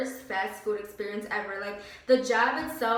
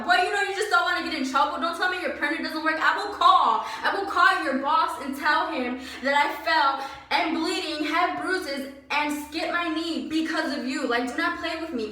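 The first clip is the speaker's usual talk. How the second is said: louder, higher-pitched telling-off; heated retort; 5 semitones higher, 4 dB louder than usual. Retold or imitated. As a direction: lay into the boss with raised voice. Retold angry confrontation with boss.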